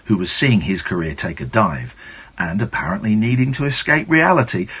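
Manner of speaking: ordinary talk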